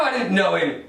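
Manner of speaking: ordinary talk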